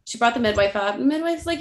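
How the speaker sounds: usual speaking voice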